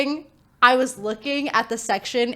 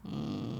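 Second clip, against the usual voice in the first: low, soft lazy dog groan; imitated dog moan; 11 semitones lower, 14 dB quieter than usual. Owner mimicking the dog at bedtime, lazy moaning. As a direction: moan like a dog settling into bed. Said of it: "he kinda, like, makes those noises".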